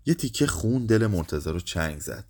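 ordinary delivery, nothing marked

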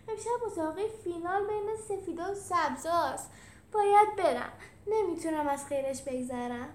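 high, loud gushing realization; agitated, high-pitched exclaiming; excited outburst. Acted. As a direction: gasp out that you cannot miss it. Remembers the big race, must go.

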